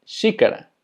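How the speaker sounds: Xícara said "SHEE-ka-ra" with sh; Portuguese X. Shown as a demonstration. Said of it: "se pronuncia xícara".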